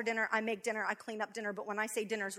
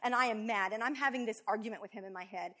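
ordinary talk

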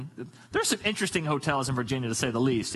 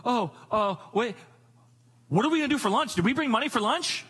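lightly imitated child, higher pitch; worrying kid; 6 semitones higher than usual. Kid frets to parents about lunch money, lightly imitated worried child. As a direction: pipe up like a kid with a sudden worry. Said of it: "one of the kids will go".

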